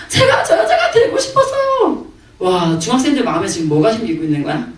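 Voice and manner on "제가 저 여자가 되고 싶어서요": very high-pitched imitation of a screaming fan; high, sustained mock squeal; mock fangirl squeal. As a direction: squeal it like an overexcited girl. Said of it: "소리를 지르는데".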